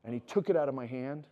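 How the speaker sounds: normal talking voice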